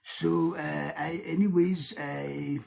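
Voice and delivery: halting mock French accent. Shown as a demonstration. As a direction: hesitate along in a French accent. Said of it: "I can do a French accent".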